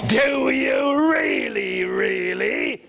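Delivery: hammed-up dragon disbelief; doubting dragon.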